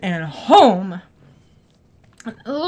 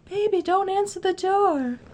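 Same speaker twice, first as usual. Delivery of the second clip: soft, higher coy purr mimicking her; mocking the other woman; 6 semitones higher, 7 dB quieter than usual. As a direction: tease her seductive plea, softer. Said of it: "She was all naked on the couch and all".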